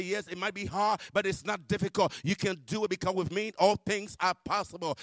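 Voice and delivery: a loud, insistent God, lightly voiced; God urging. God answering the crying believer, urging. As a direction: press the encouragement home forcefully.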